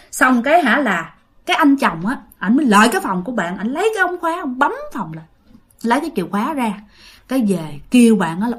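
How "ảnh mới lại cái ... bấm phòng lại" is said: plain reading voice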